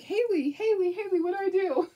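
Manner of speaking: high, frantic call for help; panicked plea. Retold or imitated. Calling her granddaughter in a high, frantic panic. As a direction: beg the vet tech for help, panicking. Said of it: "and I was like".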